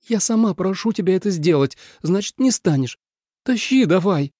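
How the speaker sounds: high, pressing female character voice; impatient heroine. A narrator's reading. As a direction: urge him on impatiently. Trapped snake urges him on.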